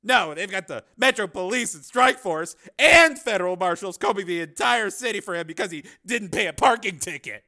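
loud, high-pitched sarcasm; mock the idea with heavy irony; sarcastic. A narrator's reading. Cameraman scoffs at reporter's faith in cyborg, sarcastic. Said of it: "Andy said dryly".

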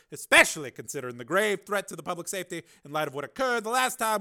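high, loud, commanding senator; Carmen, senator's voice. Acted. Senator announcing the engineer's name to the committee, commanding. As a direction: announce it with cold authority.

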